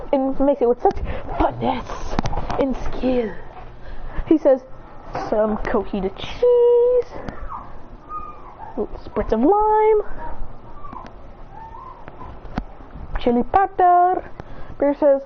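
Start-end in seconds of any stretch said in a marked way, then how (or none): 5.3-14.2 vendor call. Acted. Vendor listing toppings.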